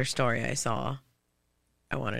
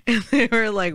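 ordinary delivery

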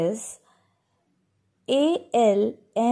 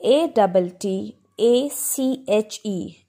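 normal talking voice

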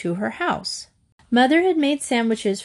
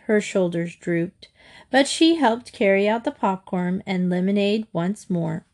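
usual speaking voice

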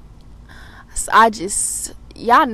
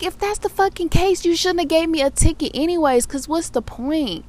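high, heated protest that winds down; indignant outburst; 8 semitones higher than usual. Retold or imitated. To the officer about the ticket, high indignant rant. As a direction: pitch it way up in outrage. Arguing with cop over ticket.

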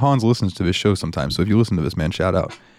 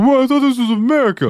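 very high, strained indignant protest; Randy from South Park; 14 semitones higher than usual. Acted. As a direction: whine it like a wronged cartoon drunk. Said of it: "joke about Randy trying to pick fights".

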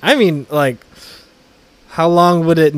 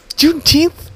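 mock confused voice; 5 dB louder, slower than usual. Mocking imagined listeners.

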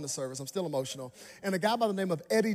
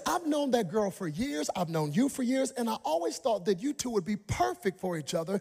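high, gushing matchmaker; excited girl; 8 semitones higher than usual. Retold or imitated. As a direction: gush like an eager friend. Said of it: "She said".

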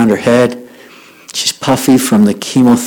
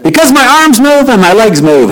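high, delighted retold answer; overjoyed girl; 10 semitones higher than usual. Happy girl answers honeymooners on the bus, overjoyed. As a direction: answer with bubbling, high-pitched joy.

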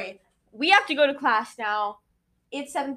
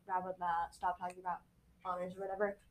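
softer, mock-bored grumble; bored listener; 4 semitones lower, 13 dB quieter than usual. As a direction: play an annoyed listener, much softer. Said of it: "you're like".

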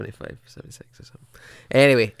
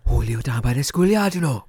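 attempted Welsh accent; 4 semitones higher, 6 dB louder than usual. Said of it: "it's hard doing Welsh".

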